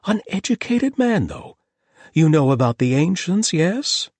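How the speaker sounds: high, loud and eager; excited host. A narrator's reading. The host asking about the ancients, high and excited. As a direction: lit up with excitement.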